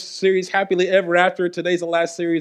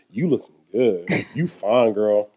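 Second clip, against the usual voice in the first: lower, slow smooth-talking flirt; smooth flirty compliment; 11 semitones lower than usual. Shown as a demonstration. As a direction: lay it on thick, sweet-talking his wife. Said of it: "I mean".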